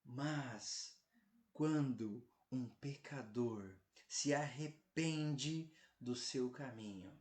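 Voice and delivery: ordinary talk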